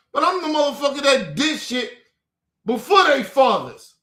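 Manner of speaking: ordinary delivery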